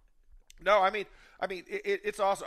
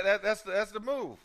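ordinary talk

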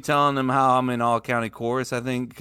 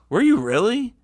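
mock disbelief; 10 semitones higher, 4 dB louder than usual. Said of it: "he was like".

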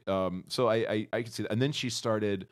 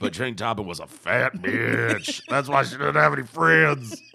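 mocking voice; 8 dB louder than usual. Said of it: "Sorry, I should do that in a New England accent".

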